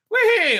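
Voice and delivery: very high, loud gleeful squeal; mock whee. Sending Baby Yoda off with the Mandos, a high mock squeal. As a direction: squeal like a kid on a slide.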